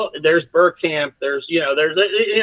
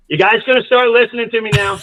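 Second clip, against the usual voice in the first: raised, louder coach's voice to the bench; mock challenge; 6 semitones higher, 5 dB louder than usual. Coach turning to his bench, lightly acted loud challenge. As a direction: retell it as a pointed, loud challenge.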